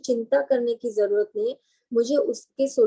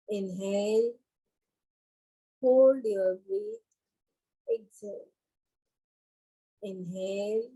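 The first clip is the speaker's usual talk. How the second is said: guided meditation; much slower than usual. Acted. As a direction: lead calm breathing, word by word, with long pauses. Leads relaxation and breathing. (धीमी, शांत ध्यान कराने वाली आवाज़)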